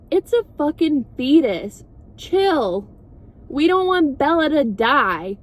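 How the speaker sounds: high, hammed-up exasperated retort; mock exasperated. Alice arguing back at Rosalie over Bella, mock exasperated. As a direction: push back in an imitated argument. Acted retort in a retold quarrel.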